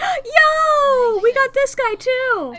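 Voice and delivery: high, loud burst of delighted surprise; excited recognition. Real, not acted. Viewer spotting a familiar guy on screen, excited. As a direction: cry out high and loud, thrilled. Thrilled to spot another performer.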